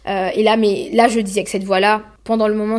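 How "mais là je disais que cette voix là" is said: ordinary talk, nothing marked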